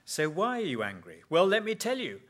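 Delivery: rising to a loud, high retort; a hint of an indignant angry person; acted counselling exchange. Counselled person bursting out at the counsellor, mock indignant. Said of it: "the conversation goes like this".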